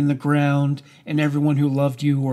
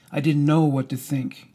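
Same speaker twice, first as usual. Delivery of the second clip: ordinary delivery, nothing marked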